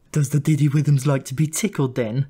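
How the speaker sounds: high, cooing auntie voice; cooing Lucy. A narrator's reading. Lucy coos over the little brother, high and doting. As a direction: coo at the little boy like a doting aunt. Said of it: "she'd say".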